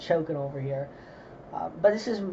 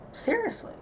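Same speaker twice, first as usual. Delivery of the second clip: incredulous; 3 dB quieter than usual. Real, not acted. Outraged aside on Afghanistan.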